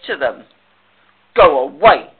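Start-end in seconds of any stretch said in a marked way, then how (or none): 1.3-2.1 low, loud, grumpy dismissal; sulky grouch, pitched down. Acted. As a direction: send them off like a grump. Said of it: "said the heap grumpily".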